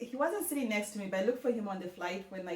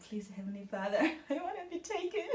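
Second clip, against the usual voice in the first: freaking out; 6 semitones higher, 5 dB quieter, faster than usual. Retold or imitated. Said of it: "I'm just freaking out".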